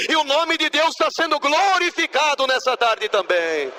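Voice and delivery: high, impassioned preaching that keeps climbing; fervent preaching. Acted. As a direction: carry the testimony up into a full sermon peak. Testimony rises into preaching.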